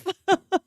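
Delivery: a short high burst of laughter. Real, not acted. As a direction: giggle at your own line. Laughing after retort.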